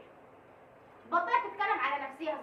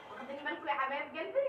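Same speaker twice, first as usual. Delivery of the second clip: laughing; 5 semitones higher than usual. Real, not acted. Laughing at viewers.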